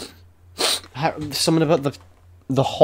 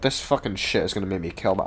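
ordinary talk

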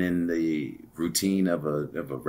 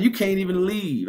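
much higher, fast mocking jab; teasing taunt; 14 semitones higher, 5 dB louder than usual. Taunting the angry man stuck at the elevator, high mocking. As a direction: needle him playfully.

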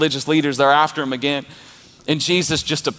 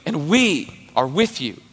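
full-force preaching; 5 semitones higher than usual. Acted. Pastor rallying the congregation, preaching at full force. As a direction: preach it hard to the crowd.

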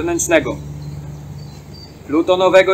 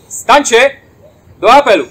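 loud ceremonial call to stand; 5 semitones higher, 5 dB louder than usual. Real, not acted. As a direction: call the fallen to roll call. Ceremonial roll call summons.

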